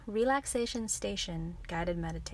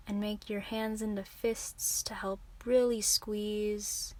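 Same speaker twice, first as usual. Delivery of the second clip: normal talking voice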